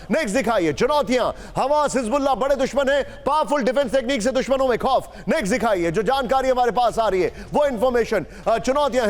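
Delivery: fast, punchy news anchor delivery; breaking news read. Acted. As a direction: hype the headlines like a TV anchor. Anchor presents graphics and breaking news. (Breaking news anchor अंदाज़)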